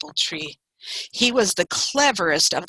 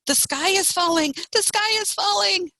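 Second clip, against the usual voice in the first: high-pitched chicken alarm; crying out; 11 semitones higher than usual. A narrator's reading. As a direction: cry out high, like excited hens. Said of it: "they cried".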